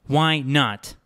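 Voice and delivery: demanding. A narrator's reading. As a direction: push back hard, demanding a reason. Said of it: "she demanded".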